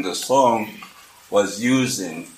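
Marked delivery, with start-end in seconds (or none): none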